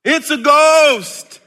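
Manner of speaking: loud, high, slow scared cry; terrified cry. Acted. Disciples in the storm, loud high scared cry. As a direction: shout it as a frightened disciple. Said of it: "they cried out in fear".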